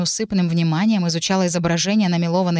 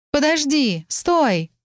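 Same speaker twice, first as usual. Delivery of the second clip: higher calling out after her; 8 semitones higher, much slower than usual. Acted. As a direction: call after someone running away. Amelia chasing Mary, calling out.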